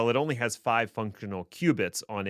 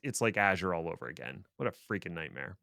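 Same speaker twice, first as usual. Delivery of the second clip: ordinary talk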